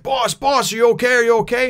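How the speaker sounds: high, loud, panicked calling for the boss; frantic calls. Retold or imitated. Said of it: "and they're like".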